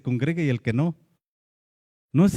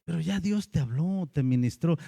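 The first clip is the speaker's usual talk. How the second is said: usual speaking voice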